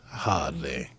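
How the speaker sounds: a hint of a low English screen accent; James Mason impression. Disputing that he was American, mock English actor voice. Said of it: "my bad James Mason accent".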